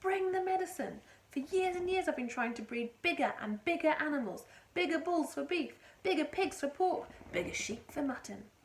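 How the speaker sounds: excited father. A narrator's reading. Mister Cranky telling George his breeding dreams. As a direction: rattle off his ambitions.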